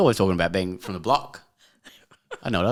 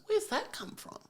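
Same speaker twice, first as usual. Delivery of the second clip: very high, soft squeaky mock voice; mock husband; 12 dB quieter, slower than usual. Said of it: "pulls anything out of it and goes".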